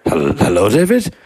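mock uncertain greeting, rising sharply; puzzled onlooker. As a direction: imitate someone baffled, interrupting tentatively. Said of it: "the person goes".

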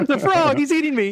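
high, whiny mock panic; mock terror. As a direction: pretend the frog is eating you. Joking cry of being eaten.

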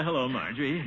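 ordinary talk, nothing marked

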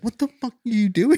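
high-pitched, incredulous outburst; exasperated disbelief. Retold or imitated. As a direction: scold a fool in comic exasperation. Imagined scolding of the cameraman.

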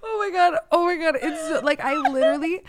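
high, wheezy laughing voice; crying with laughter at the memory; high giggling squeal. Real, not acted. Laughing at the story.

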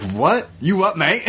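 incredulous question quoted back; mock confusion. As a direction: play it baffled and a bit affronted. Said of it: "And you're like".

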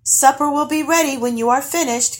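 high call to someone leaving; singsong-free high farewell call. A narrator's reading. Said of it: "called Guri as he left".

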